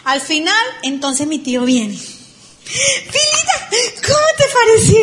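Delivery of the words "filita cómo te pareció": very high, drawn-out eager call; imitating the uncle. Imitating the excited uncle asking his niece her opinion, high and loud.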